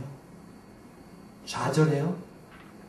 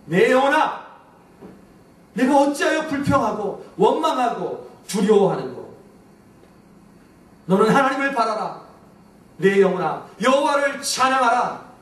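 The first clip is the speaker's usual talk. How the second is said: loud, high preached command; commanding the soul; 5 semitones higher, 4 dB louder than usual. Shown as a demonstration. Preacher showing how to command one's soul, loud and high. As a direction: order your own soul to wake up. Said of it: "그 영혼에게 우리는 즉시 이렇게 말해야 합니다".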